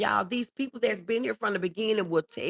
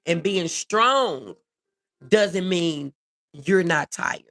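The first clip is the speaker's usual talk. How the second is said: slow emphatic; 3 semitones lower, slower than usual. Acted. Stressing point to live viewers.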